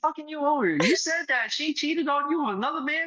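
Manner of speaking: sugary high mock-caring tone; mock sympathy. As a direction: ham up fake concern for a seller. Mocking fake-sympathetic listening to sellers.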